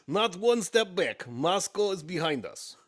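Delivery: put-on slow, loud, heroic Russian accent; rally the troops in a mock Russian accent. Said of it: "Russian accent".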